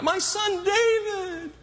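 high, slow, drawn-out call; stretched high-pitched storybook call; Saul. Retold or imitated. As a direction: call out plaintively to someone far away. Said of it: "Here he is going after his life".

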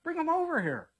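high, eager order to the crowd; Jesus commanding. Acted. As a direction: call the crowd to fetch him. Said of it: "he tells the people in the crowd".

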